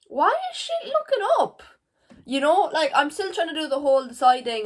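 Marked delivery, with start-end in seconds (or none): 0.1-1.6 mock disbelief. Real, not acted. Disbelief at good times.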